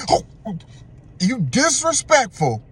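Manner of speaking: high, drawn-out indignation; mock offended outburst. Man reacting to being called nonchalant, hammed-up offence. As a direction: act hugely affronted, stretching the words. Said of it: "That's how he responds".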